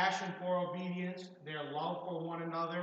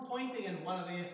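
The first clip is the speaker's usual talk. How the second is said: usual speaking voice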